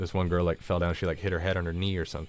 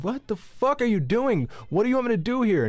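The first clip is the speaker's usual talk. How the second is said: exasperated outburst; 14 semitones higher than usual. Retold or imitated. Man grabbing the stranger's phone, yelling at her in disbelief. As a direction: throw it at her like you've had enough. Angry confrontation over the phone.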